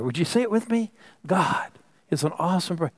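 high, ringing preacher's call; preaching at full force. Real, not acted. Pastor rousing the congregation to repeat, preaching fervently. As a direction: lift the congregation, preaching hard.

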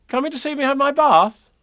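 put-on high childlike boy asking; a little boy's hopeful question; small boy voice. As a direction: voice young Christopher Robin up high. Christopher Robin voiced in story.

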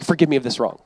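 soft, dry mock apology; understated sarcastic apology. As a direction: hand it back with a quiet sneer. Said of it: "And he's sarcastic".

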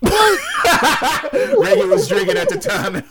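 high, loud laughing blurt; bursting out laughing; laughing shout. Real, not acted. Cracking up telling story.

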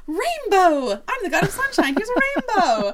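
high, laughing sunshine-god cry; mock excited proclamation. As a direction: announce it with hammed-up glee. Sunshine god proudly presents a rainbow.

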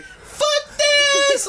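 loud, high mock baby yell; imitated baby. Imitating a baby standing in the cinema aisle, high mock yell. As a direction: squeal it out like a cursing toddler. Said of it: "Like, in baby".

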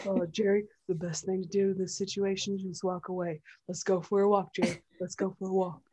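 calm slack-jawed dog, softly; dopey dog voice. Retold or imitated. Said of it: "every voice that we give our bigger dogs".

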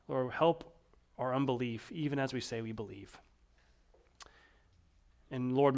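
hushed closing prayer, softening at the end. Real, not acted. Pastor praying over the congregation, hushed. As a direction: pray it gently to God.

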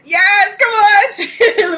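high, loud whoop with a laugh in it; excited cheer. Real, not acted. Cheering dancers on.

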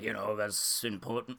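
a lightly done Obama; soft, low mock-Obama wrap-up line; Obama impression. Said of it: "[name] Obama impressions".

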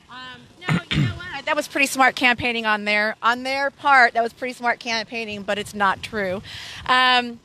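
usual speaking voice

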